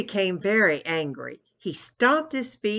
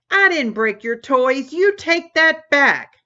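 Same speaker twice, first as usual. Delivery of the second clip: higher, louder angry yell; a sister bellowing at her brother over broken toys; 7 semitones higher, 8 dB louder than usual. Acted. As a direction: bellow it back at him. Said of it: "bellowed Tammy".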